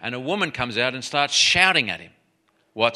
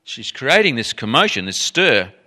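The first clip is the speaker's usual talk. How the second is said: louder, higher mock complaint; acted urging; 6 semitones higher, 6 dB louder than usual. Disciples urging Jesus to send her away, acted loud complaint. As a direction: urge insistently, imitating fed-up followers. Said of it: "his disciples came and urged him".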